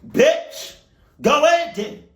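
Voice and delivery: high, explosive shout; angry outburst. Acted. Answering a wife's boast about other men, shouting angrily. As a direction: blast back at the taunt. Acted retort to wife's threat.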